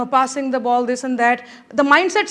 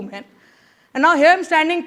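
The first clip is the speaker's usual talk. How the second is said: ordinary delivery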